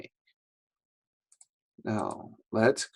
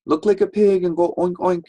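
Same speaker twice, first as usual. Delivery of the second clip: teacher; 8 semitones higher, 5 dB louder than usual. Retold or imitated. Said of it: "our teacher would say".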